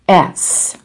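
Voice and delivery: "S" as a hissed unvoiced s sound. Shown as a demonstration. Teacher demonstrates the plural ending, hissing "S". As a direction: hiss the "S" like a snake, no vowel. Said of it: "inflection S, said as a sound".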